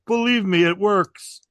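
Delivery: high, loud insistent comic impression; Minervini. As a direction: imitate a comic vouching for his bit, voice pushed up. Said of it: "Minervini goes".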